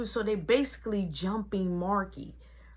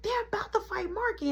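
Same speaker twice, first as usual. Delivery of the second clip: mock excited yell; 12 semitones higher than usual. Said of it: "I hear Caleb, but he's, like, jumping".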